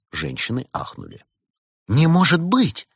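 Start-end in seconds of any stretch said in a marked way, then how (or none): 1.9-2.9 high, slow, loud gasping exclamation; scandalized cry; shocked disbelief. A narrator's reading. Women gasp at the accusation.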